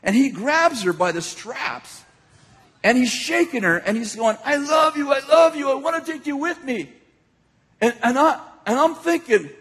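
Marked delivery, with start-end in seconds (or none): 4.4-6.9 high-pitched imitation of a raving man; mock frantic yelling. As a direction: cry it out like a man shaking someone. Man shaking woman, yelling love.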